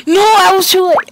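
high, loud outburst of dismay; real dismayed yelp; dismayed cry. Real, not acted. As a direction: cry out at a missed chance. Misses a move in game.

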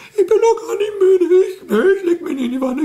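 very high whining puppet voice, near tears; a squeaky little creature protesting bedtime; Elfriede's squeaky voice. Acted. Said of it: "Das ist bei Elfriede genauso".

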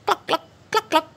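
high, quick imitated clucks; hen clucking.

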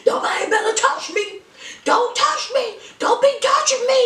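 high fast yelling man; squealing don't touch me, hammed-up; frantic high-pitched protest; mock Michael. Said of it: "talking about".

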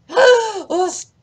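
sudden high yelp of shock; startled cry. Real, not acted. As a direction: jump at the sight.

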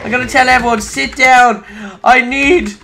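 ordinary delivery

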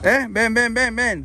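repeated coaxing call; calling someone over. Real, not acted. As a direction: urge him to come along. Calling the drunk man over.

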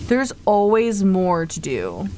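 usual speaking voice

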